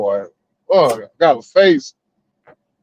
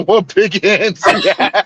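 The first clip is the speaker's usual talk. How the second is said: laughing. Acted.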